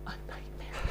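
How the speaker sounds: a confiding whisper. Real, not acted. Whispering her verdict on the actress to the interviewer. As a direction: drop to a secretive whisper.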